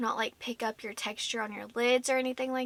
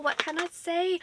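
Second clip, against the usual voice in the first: breathless and pitched higher; out of breath; 6 semitones higher than usual. Real, not acted. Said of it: "Sorry if I'm breathing heavy".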